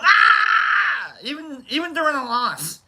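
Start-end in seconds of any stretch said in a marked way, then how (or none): 0.0-1.1 sudden high yell of a cheering fan; retold fan yell. Chargers fan yelling in the enemy stadium, high yell. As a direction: yell out like a rowdy fan in the stands. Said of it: "I'm the guy going".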